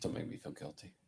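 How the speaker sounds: hushed whisper. Acted. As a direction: whisper it guiltily. Whispered joke in podcast.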